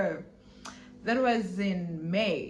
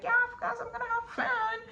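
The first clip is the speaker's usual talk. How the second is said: much higher, fast, eager answer; excited reply; faster than usual. Retold or imitated. Traveller eagerly promising the officer to have fun. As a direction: gush back, pitched way up and quick. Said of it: "I was like".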